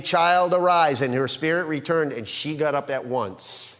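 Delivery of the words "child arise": put-on louder call to the child. Shown as a demonstration. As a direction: call the girl to rise. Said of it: "he called, saying".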